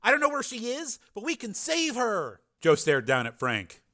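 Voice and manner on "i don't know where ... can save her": high, pleading insistence; urgent hope. A narrator's reading. Frank urging a rescue, hopeful pleading. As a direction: urge him to believe it.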